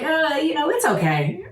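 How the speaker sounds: lukewarm shrug. Retold or imitated. Shawnee giving her mild verdict on Hamnet, mock lukewarm. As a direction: imitate someone who is politely unimpressed. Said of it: "Shawnee was like".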